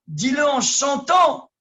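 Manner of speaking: slow, high and loud exhortation; preacher urging the crowd to sing; joyful shout. Real, not acted. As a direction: call it out with joy.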